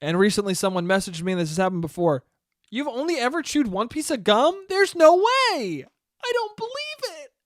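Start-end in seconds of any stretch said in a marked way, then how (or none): 2.7-7.3 rising higher into squeaky incredulity; mock disbelief. Dating app match doubting his gum fact, mock disbelief climbing higher. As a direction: mimic someone who can't believe it.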